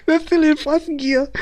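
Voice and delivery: words pushed out high through laughter; can't hold back the laugh while saying it; amused laughing. Real, not acted. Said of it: "I'm laughing".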